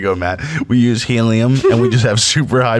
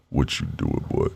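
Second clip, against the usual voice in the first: deep voice; 9 dB quieter than usual. Acted. Plays a deep voice again.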